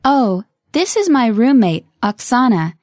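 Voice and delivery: slow and over enunciating; each word spelled out carefully; slowly. Shown as a demonstration. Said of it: "one more time slowly".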